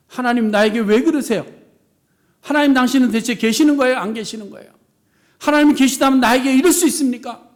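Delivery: loud, indignant plea to God; resentful complaint. Acted. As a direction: cry out in bitter protest against God. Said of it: "라고 원망할 수 있습니다".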